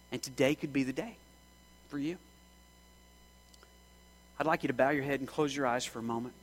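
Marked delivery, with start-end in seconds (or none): none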